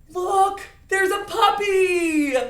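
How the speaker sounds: loud, high, thrilled cry; happy. Shown as a demonstration. Teacher pointing out a puppy to children, happy. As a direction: shout it with a long, joyful last word. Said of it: "saying it in a happy way".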